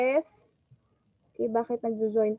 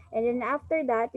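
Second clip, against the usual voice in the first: normal talking voice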